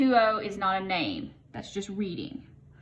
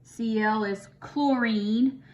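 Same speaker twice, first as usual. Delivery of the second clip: ordinary talk, nothing marked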